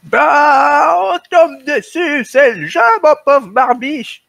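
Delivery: put-on high, slow old man voice; little old voice. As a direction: talk like a doddering old man. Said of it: "leur petite voix de vieux".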